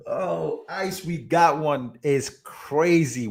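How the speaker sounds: high, slow, laughing repeat of the joke; giggling retelling, high and slow. Retold or imitated. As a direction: laugh your way through the line, high and slow.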